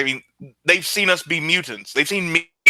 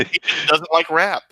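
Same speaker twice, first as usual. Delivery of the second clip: quip said up clearly after a laugh; amused, clearer repeat of a quip; repeated on request; 3 semitones higher than usual. Real, not acted. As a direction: repeat the joke so it can be heard. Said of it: "Did I say that again?".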